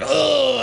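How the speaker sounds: high hammed-up shouted grunt; a cartoon monster's roar of disgust; mock zombie groan. Vampire throwing the steak, mock shouted grunt. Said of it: "He's like".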